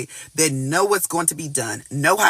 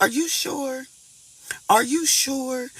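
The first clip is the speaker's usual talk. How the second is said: put-on higher, softer and slow, doubtful child voice; timid little me; 5 semitones higher, 4 dB quieter, slower than usual. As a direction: ask it like a scared small child. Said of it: "little me is like".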